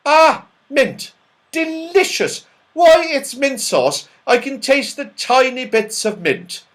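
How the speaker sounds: put-on high, loud, eager old pedlar; high, loud and thrilled with the taste; Saucepan's voice. A narrator's reading. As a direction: savour it like a delighted, slightly deaf old man. Saucepan voiced in read story.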